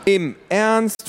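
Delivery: high, loud disbelief; can't believe it; incredulous. Acted. Teen sister shocked the neighbor stays, loud disbelief. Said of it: "fragte Luisa".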